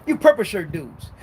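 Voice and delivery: normal talking voice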